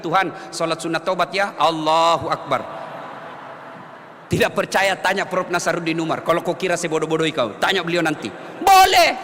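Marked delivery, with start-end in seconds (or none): 0.0-2.9 simple prayer demo. Shown as a demonstration. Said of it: "bilang saja begini". 8.6-9.2 loud punchy "Boleh"; emphatic shout. Real, not acted. Preacher insists to the congregation that it is allowed, loudly.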